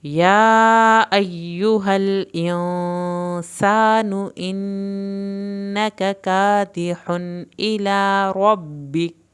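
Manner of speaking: slow, drawn-out chanted recitation; Quranic recitation. Shown as a demonstration. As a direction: recite the whole verse slowly with tajweed. Full verse recited as model.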